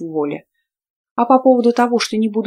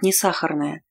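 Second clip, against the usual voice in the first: usual speaking voice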